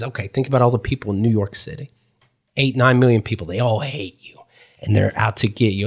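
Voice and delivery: low, hushed, ominous guiding voice; seminar leader. Retold or imitated. Leader says all New York hates you, low ominous voice. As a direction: lay out the threat low and soft.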